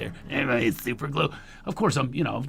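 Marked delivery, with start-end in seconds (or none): 0.3-1.3 lightly voiced raspy older smoker; a hint of worn rasp; mild impersonation of a raspy drinker; raspy Don Johnson. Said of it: "It's raspy anyway".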